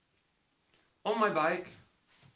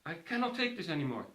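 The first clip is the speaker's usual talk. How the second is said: near tears; 6 semitones higher than usual. Retold or imitated. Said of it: "I'm like".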